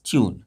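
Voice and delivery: ट्यून said softly as "tyoon", glided y before oo; model pronunciation. Shown as a demonstration. Teacher models ट्यून for children, soft and careful. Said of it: "ऐसे पढ़ते हैं".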